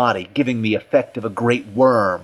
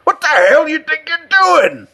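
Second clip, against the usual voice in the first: very high, loud, frantic yell; hoarse frantic demand; 7 dB louder than usual. A narrator's reading. Cut and Shoot confronts Richardson, hoarse and frantic. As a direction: shout it in hoarse panic. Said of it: "A hoarse, frantic voice behind him demanded".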